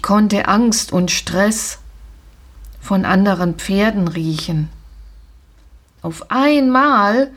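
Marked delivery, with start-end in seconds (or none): none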